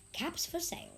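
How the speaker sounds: usual speaking voice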